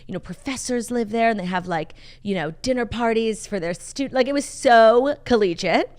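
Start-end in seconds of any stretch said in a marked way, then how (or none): none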